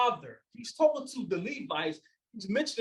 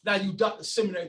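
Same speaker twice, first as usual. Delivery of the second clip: ordinary delivery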